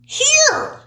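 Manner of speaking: high, loud sure answer; surprised. Real, not acted. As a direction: blurt it out.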